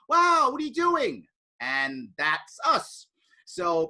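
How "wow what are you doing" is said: high, loud, slow disbelief; stunned, scolding your own party; incredulous. Retold or imitated. Speaker reacting to party members yelling at newcomer, incredulous. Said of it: "I'm like".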